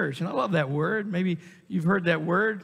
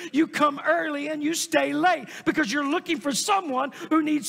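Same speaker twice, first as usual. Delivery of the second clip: higher, driving preacher cadence; fervent preaching; 7 semitones higher than usual. Acted. Preacher urging the congregation to serve, fervent preaching. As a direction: preach it with rising fervor.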